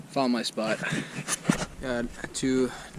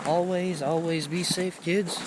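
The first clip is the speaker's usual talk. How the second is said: safety PSA voice; 5 semitones higher than usual. Acted. Mock safety PSA to kids.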